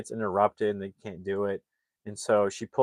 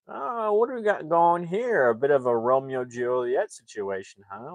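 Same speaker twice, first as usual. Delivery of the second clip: creepy stranger; 4 semitones higher than usual. Retold or imitated. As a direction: play a creepy stranger butting in, stretching it out. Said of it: "he starts acting really creepy".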